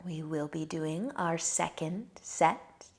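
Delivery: ordinary talk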